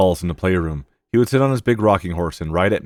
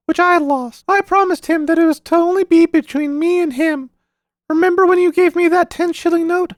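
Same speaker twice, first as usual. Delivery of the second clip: put-on high, serious child voice; a small boy confiding his secret partnership; earnest boy; 6 dB louder than usual. A narrator's reading. Paul explaining to his uncle, high earnest child voice.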